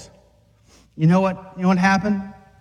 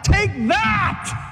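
triumphant shout; 11 semitones higher than usual. Retold or imitated. A preacher imagining wiping out enemies, shouting in triumph. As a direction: strike them all down with a yell.

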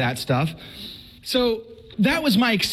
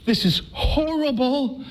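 dread; 6 semitones higher, slower than usual. Retold or imitated. First confession dread.

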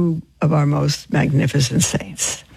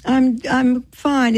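ordinary talk

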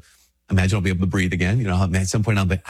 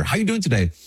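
pitched much higher, a chirpy passer-by asking; mock friendly greeting; 10 semitones higher, faster than usual. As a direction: play the cheery acquaintance who asks.

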